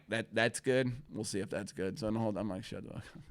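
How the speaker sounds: soft, low under-breath grumble; quietly grumbling at the kid; muttering. Retold or imitated. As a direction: grumble it to yourself. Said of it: "And I'm like".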